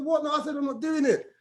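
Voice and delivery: high-pitched panicky protest; retold panic, voice shooting up; alarmed refusal. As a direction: balk in mock alarm. Acting out his refusal to jump.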